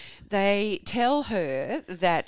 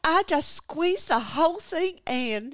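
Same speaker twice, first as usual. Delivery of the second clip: high, drawn-out Southern confession; hammed-up angry Southern drawl, building louder; American Southern accent; 9 semitones higher, 4 dB louder, slower than usual. Wife confessing at home, high angry American Southern accent. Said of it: "make Lana like this".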